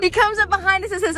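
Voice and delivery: ordinary talk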